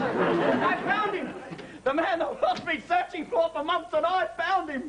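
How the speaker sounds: high, excited cry of discovery; triumphant announcement. Acted. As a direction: burst in proudly with big news. Bursts in announcing his find.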